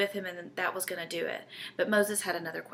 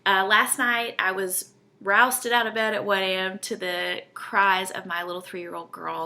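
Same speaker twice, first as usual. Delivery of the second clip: usual speaking voice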